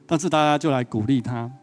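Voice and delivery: normal talking voice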